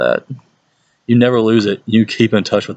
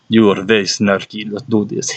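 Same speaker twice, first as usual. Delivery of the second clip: put-on lightly Russian-accented deadpan; a hint of a dry Russian teacher; mild Russian-accented retort; Russian teacher impression. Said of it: "You go".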